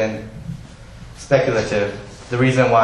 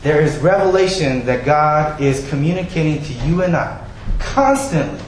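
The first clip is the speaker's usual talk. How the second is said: usual speaking voice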